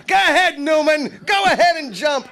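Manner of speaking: high, loud yelling; Kramer yelling. Retold or imitated. Kramer yells out the window at Newman, loud and high. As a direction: bellow it out the window with gusto. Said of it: "Kramer going".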